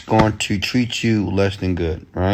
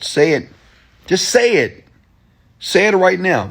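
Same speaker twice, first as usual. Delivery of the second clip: high-pitched, pressing command; insistent urging; 10 semitones higher, 4 dB louder than usual. Real, not acted. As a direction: urge them on with your pitch jumping high. Urging viewers to say it aloud.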